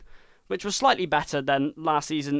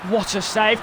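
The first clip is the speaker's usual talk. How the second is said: ordinary talk, nothing marked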